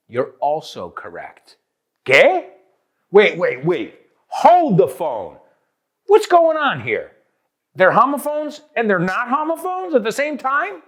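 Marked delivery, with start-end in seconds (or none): 2.0-10.8 high-pitched, loud playful bewilderment; hammed-up confused outburst; mock disbelief. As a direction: act baffled and cry out for a halt. Acts out a confused student's reaction.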